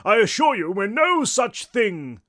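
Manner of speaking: slow, loud, high haughty denial; scoffing English ghost. A narrator's reading. Tall ghost denying he is a ghost, scoffing English. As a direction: scoff grandly, drawing out the words. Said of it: "scoffed the tall ghost".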